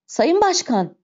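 high-pitched cry for help; distressed call, pitched high; alarmed cry. A narrator's reading. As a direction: cry out to him, voice jumping up. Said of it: "diye bağırdı Fontaine".

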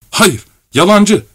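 put-on high, drawn-out child's accusation; harsh retort. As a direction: fire back sternly, high and slow. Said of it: "diye sertçe yanıtladı çocuk". (sertçe, tiz çocuk sesiyle)

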